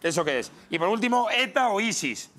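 ordinary talk, nothing marked

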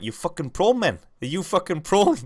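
high, loud South African movie impression; South African accent. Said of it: "in the South African accent is quotes from".

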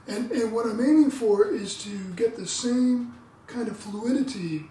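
ordinary delivery

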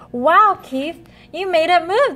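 high, loud, wide-eyed wow; amazed gasp. Acted. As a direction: gasp it in wonder. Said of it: "they gasped".

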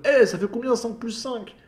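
ordinary delivery

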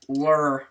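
"Lure" demonstrated as a short "lurr"; claimed Canadian pronunciation. Shown as a demonstration. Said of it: "This is how they pronounce it in Canada".

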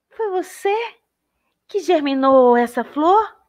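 a charmed wanderer gushing over a bloom; admiring traveler. Acted.

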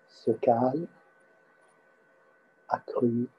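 normal talking voice

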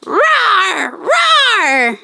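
mock toy dinosaur roar, rising; playful dino roar. Host voicing toy dinosaur for kids, playful roar. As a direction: growl it out for the kids.

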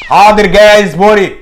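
loud call to someone offstage. Acted. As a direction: shout toward the next room. Calling out to wife offstage.

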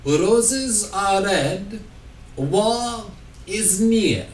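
slow poem in a hammed-up Russian accent; mock Russian accent, drawn out. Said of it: "Why are you Russian?".

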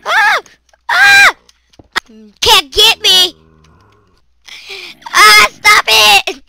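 high loud frightened yells, slowing on the words; panicked shrieks. Real, not acted. Gamer swarmed by mobs, panicked shrieks. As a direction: scream as the monsters close in.

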